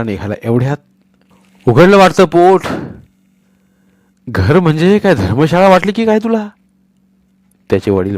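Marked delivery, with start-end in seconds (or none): 1.7-6.5 angry scolding. Acted. Father scolds son.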